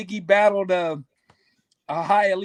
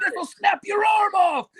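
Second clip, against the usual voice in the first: higher, shouted warning; excited shout; 11 semitones higher than usual. Retold or imitated. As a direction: shout it out holding up the snapping turtle. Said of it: "He said".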